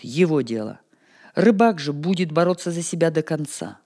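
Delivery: ordinary delivery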